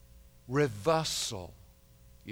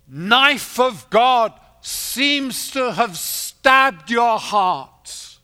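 loud, high, ringing sermon voice; rising pulpit proclamation; full-force preaching; 7 semitones higher, 10 dB louder than usual. Acted. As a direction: preach it out to the whole hall.

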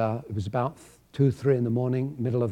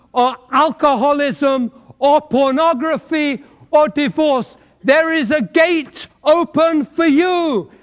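building to a high, loud shout; preaching at full force; 13 semitones higher, 11 dB louder, slower than usual. Acted. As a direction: throw the gates open with the voice.

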